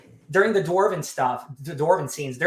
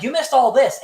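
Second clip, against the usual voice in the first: retold outburst; 9 semitones higher, 6 dB louder than usual. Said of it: "when he's like".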